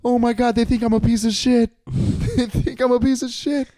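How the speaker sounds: high-pitched mock panic; dismayed second thought. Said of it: "the second thought was".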